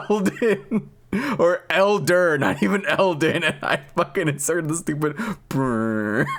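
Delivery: high-pitched giggly disbelief; laughing at yourself, voice up high. Real, not acted. Laughing at own old vod.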